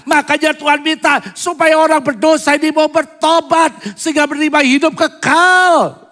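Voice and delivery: high, loud preaching at full force. Acted. Pastor urging the congregation, loud fervent preaching. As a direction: preach it out with rising passion.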